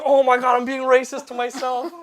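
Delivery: loud, high-pitched dismay; mock horrified realisation. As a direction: imitate someone appalled at their own blunder. Retold coworker realising his mistake.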